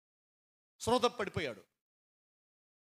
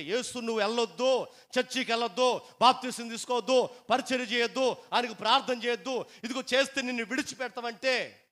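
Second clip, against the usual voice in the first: 1 family forbidding; 6 semitones higher, 6 dB louder than usual. Retold or imitated. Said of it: "వాళ్ళు అడ్డుపడిపోతే".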